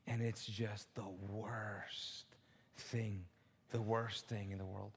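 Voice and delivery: soft, slow, whispered dread; hushed. Real, not acted. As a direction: drop to a whisper. Describing envy rotting.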